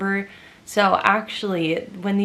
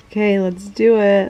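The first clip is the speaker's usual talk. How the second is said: nervous excitement; 7 dB louder than usual. Real, not acted. Random generator winner draw.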